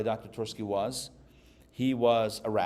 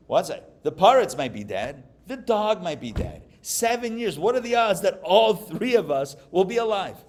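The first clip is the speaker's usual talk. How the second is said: higher, louder, building case; excited reasoning; 6 semitones higher, 6 dB louder than usual. Retold or imitated. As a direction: argue it up with glee.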